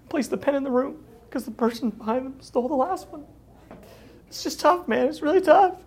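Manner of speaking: rising into a high, whiny falsetto; mock weepy billionaire. Mocking Ackman's tearful call-in.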